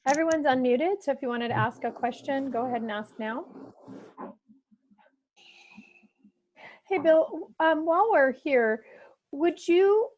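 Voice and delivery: loud, distant call audio. Real, not acted. Host speaking over call.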